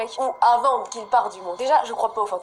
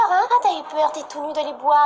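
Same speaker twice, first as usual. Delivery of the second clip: gleeful taunt; 4 semitones higher than usual. Real, not acted. Taunting the scared character.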